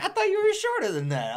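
high mocking voice through a laugh; imitating KJ laughing. KJ ribbing his friend, high and laughing. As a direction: tease high while laughing. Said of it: "He's like".

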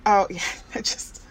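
teary laughter. Real, not acted. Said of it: "Cry if you need to".

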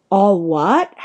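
loud, outraged "all what?"; thundering, with a steep rise at the end; thundered question. A narrator's reading. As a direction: boom it back in disbelief. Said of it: "Hagrid thundered".

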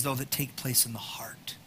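whispered confession of the heart. Real, not acted. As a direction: drop to a bare whisper. Inward point about the heart.

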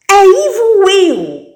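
put-on the baby announcing he is coming, loud slow yell; baby shout. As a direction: yell it out as you come. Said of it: "gritou o bebê".

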